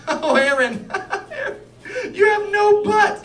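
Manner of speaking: high, loud voice breaking up with giggles; helplessly amused, mocking through laughter; laughing wife impression. Wife teasing husband about his body, laughing hard.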